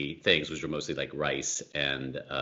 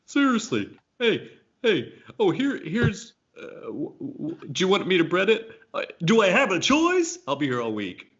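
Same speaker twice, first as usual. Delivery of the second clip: much higher put-on comedian voice, hammed up; mock stand-up bit, voice pitched way up; cheesy stand-up comic; 14 semitones higher, 6 dB louder than usual. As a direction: ham it up like a corny club comic working the room. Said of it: "that was my stand-up there".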